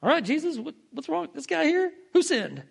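mock casual curiosity. Disciples asking Jesus about the blind man, mock uncaring curiosity. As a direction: lightly imitate someone idly posing a riddle. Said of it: "not someone to extend care and compassion to".

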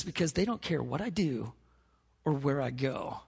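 soft, subdued, sinking delivery; retold hurt. Crying Johnny explaining himself to youth pastor, quietly dejected. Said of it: "He's crying".

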